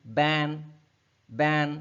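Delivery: बैन with the vowel pushed through the nose; nasalized vowel. Shown as a demonstration. Teacher showing students how a vowel becomes nasalized. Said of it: "ए की बजाय ए ऐसे बोला जा रहा है".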